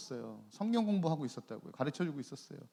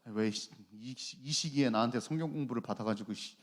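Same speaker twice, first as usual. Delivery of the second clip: low, put-on grumbling; mock resentful grumble; 7 semitones lower than usual. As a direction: grumble to yourself as if begrudging a gift. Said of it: "이렇게 선물 샀을까요?".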